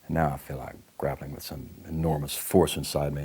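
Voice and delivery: ordinary talk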